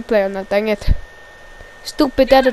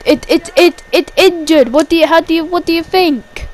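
higher, louder stuttered retort; exasperated reply; 5 semitones higher, 4 dB louder than usual. Acted. Wrestler asked how his arm is, exasperated. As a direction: stammer it out in exasperation. Testy answer about injured arm.

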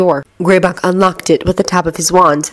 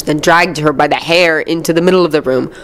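ordinary delivery, nothing marked

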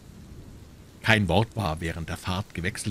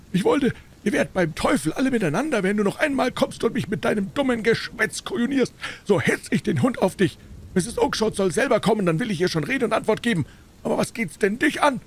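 hohe, erboste Händlerstimme; wütendes Schreien; 4 dB louder than usual. A narrator's reading. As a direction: den lästigen Frager zornig anfahren. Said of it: "schrie er dabei". (aufgebrachtes Schimpfen)

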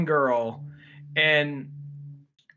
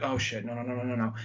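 hushed backing off; 5 semitones lower, 7 dB quieter, faster than usual. Retold or imitated. Acts out awkward onlooker backing off.

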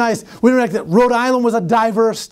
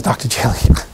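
hushed whisper at the door; whispered warning; 10 semitones lower than usual. Retold or imitated. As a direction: whisper it so the visitor won't hear. Secretary whispers about waiting candidate.

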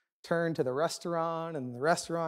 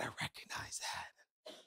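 slow, whispered realization; hushed recognition; 18 dB quieter, slower than usual. Retold or imitated. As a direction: whisper it slowly, as if it dawns on them. Quoting onlookers' hushed recognition.

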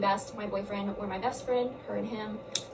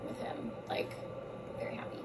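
whispering; 11 dB quieter than usual. Real, not acted. Gushing softly.

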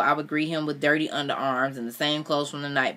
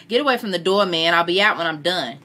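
louder and higher call past a closed door; yelled through a door; 4 semitones higher, 6 dB louder than usual. A narrator's reading. Man yells at impatient friend through the door, loud. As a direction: shout him off through the door. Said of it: "Chance yelled through the closed door".

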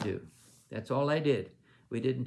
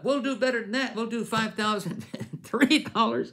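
much higher and louder, topping the last pledge; eager outbidding; 10 semitones higher, 6 dB louder than usual. Retold or imitated. Another pastor jumping up to top the pledge, high loud excited call.

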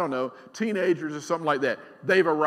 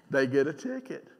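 normal talking voice

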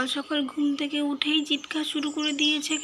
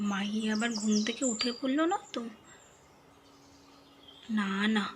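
normal talking voice

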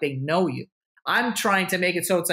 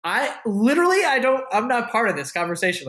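exasperated protest; 7 semitones higher than usual. Retold or imitated. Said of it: "and I'm just like".

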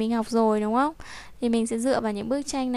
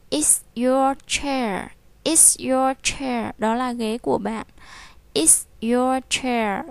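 slow drill reading; slower than usual. Shown as a demonstration. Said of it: "teacher drills".

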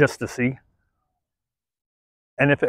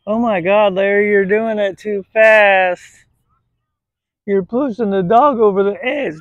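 hammed-up protest, pitched up; mimicked critic; 6 semitones higher than usual. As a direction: imitate a scandalized viewer fretting at the trainer. Mimics critics complaining to him.

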